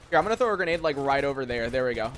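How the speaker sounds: normal talking voice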